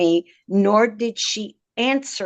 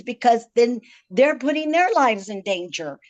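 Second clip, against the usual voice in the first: normal talking voice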